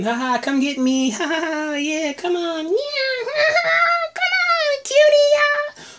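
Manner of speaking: put-on very high, giggling child voice, slowing; giggly creepy girl. Lizzie taunting the walker in the yard, giggling and very high. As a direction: tease the walker like a playmate, laughing.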